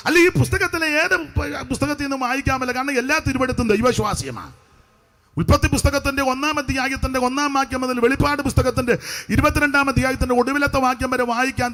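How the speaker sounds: emphatic, rhythmic pulpit delivery; preaching. Real, not acted. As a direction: preach it out to the congregation, phrase by phrase. (ഊന്നിപ്പറയുന്ന സുവിശേഷ പ്രസംഗം)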